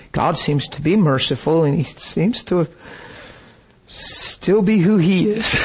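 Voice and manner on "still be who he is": ordinary delivery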